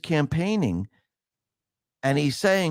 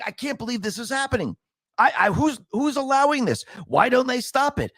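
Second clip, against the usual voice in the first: high, rising disbelief, mock angry; mock outraged citizen; 9 semitones higher than usual. Fed-up voter ranting at the system, mock angry high outburst. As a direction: play the fed-up voter who can't believe it. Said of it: "you getting really angry and saying".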